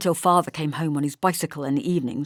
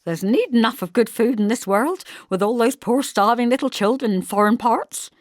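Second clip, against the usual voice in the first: high, loud indignant retort; 5 semitones higher, 6 dB louder than usual. A narrator's reading. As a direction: bristle back at the teasing. Mother continues indignant.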